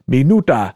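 Minuta sounded out Russian-style as mee-NOO-tah; Russian pronunciation. Shown as a demonstration. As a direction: show how Russian Minuta sounds, stressing "nu". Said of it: "that's what it sounded like".

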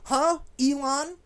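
high, loud huh; indignant surprise. Real, not acted. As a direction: shout at Elon in disbelief. Reacting to ban.

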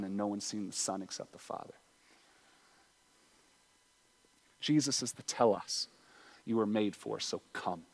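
hushed pastoral call; soft invitation. Acted. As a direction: draw them in gently, barely above a murmur. Intimate close after the appeal.

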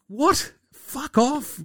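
squeaky high indignant brush-off; irritated Picasso. Retold or imitated. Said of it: "Picasso's like".

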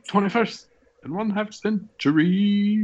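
high, slow, drawn-out title call; hammed-up cartoon title announcement; cartoon announcer. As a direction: announce it like an old cartoon intro.

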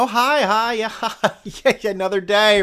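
high, bright mock-friendly neighbor voice; sarcastic imitation of a phony neighbor; fake-nice greeting. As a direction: sing out a fake cheery hello.

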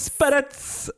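high, squeaky "Spirits" said as SPEH-nits; "Spirits" in a high, silly voice; funny-voiced "spirits". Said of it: "spent a lot of time saying spirits".

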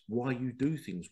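ordinary talk

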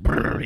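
rapid lip-flutter "brrr"; imitated bear burr. Joker imitating a grizzly bear diving in, rolling brrr. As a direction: blow a loose, rolling motorboat noise.

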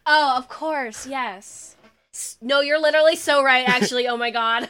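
loud, delighted outburst; excited agreement. Real, not acted. Friend thrilled by the Fran Kranz comparison, excited agreement. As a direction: agree emphatically, bursting into laughter.

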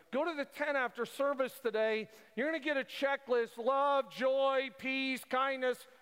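high, loud pulpit build; preaching at full force. Real, not acted. Pastor pressing the church near the close, full preaching force. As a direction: drive it home to the congregation.

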